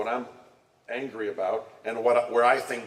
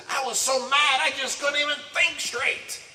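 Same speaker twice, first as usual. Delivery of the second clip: much higher, exasperated mock complaint; quoted upset person. Telling the congregation what somebody says, higher mock exasperation. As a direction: whine it like someone still fuming. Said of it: "Somebody says".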